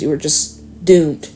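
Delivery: normal talking voice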